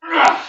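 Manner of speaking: loud, high strained ah; strained effort cry. Real, not acted. Coach burning through the last dips, loud strained cry. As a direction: push out the burn with a loud cry.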